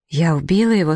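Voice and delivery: plain reading voice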